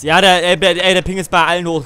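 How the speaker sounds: loud, annoyed complaint; frustrated outburst. Real, not acted. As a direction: rant about the lag. Complaining about lag.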